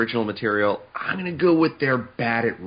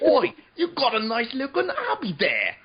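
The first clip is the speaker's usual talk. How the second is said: high, laughing mock Cockney accent; 13 semitones higher than usual. Imitating a cartoon character's bad Cockney, high and laughing. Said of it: "interesting accent ... Cockney".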